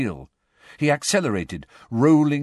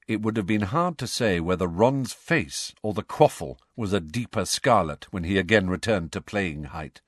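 ordinary delivery

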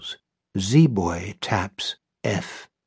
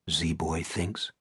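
ordinary delivery, nothing marked

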